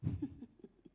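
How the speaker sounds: voiceless little snicker; silent laugh. Retold or imitated. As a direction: laugh with no voice at all. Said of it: "She laughed".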